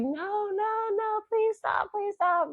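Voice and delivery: high, pleading cry of no, please stop; retelling a tearful plea for him to stop. Retold or imitated. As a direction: beg him to stop through tears. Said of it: "I remember crying in the parking lot".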